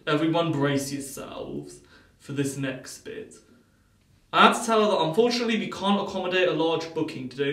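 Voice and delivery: usual speaking voice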